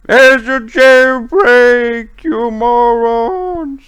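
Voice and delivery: high, drawn-out monotone yell; muffled scream. Acted. Prisoner alerting guards to a jailbreak, muffled scream. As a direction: scream it slowly from far down in the jail. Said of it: "hear a muffled scream coming".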